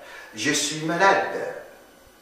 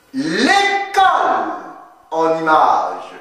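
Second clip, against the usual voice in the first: high, loud announcer call; bright TV host closing call; show sign-off; 13 semitones higher, 3 dB louder than usual. Acted. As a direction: announce the show's name with energy. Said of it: "show sign-off".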